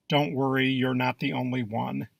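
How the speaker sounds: usual speaking voice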